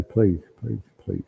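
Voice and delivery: soft pleading, dying away to almost nothing; fading plea. Real, not acted. Medium begging a spirit to show her again, pleading quietly.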